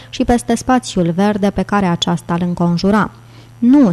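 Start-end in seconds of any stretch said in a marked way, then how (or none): 3.6-3.9 a loud, higher cry of no; shout. A narrator's reading. Langdon yelling at Sophie to stop the car, loud. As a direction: shout out a warning. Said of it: "strigă Langdon".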